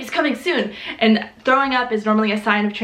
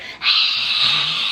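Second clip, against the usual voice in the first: animal sigh; 7 semitones lower than usual. Retold or imitated. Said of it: "like a freaking animal".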